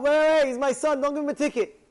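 high, loud retold protest; urgent plea. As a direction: call out to stop the cop. Retold father pleading with a cop.

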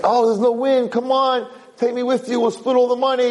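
high, begging voice of a stuck sailor; pleading brother. Acted. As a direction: plead desperately to be taken along. Stranded brother begs for a ride.

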